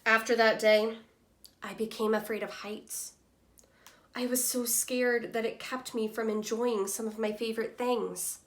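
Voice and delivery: lively storybook reading voice for a class; reading to children. Acted. Teacher reading Humpty Dumpty's story to students, lively storytelling voice. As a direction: read it like a picture book to young kids. Teacher reads picture book aloud.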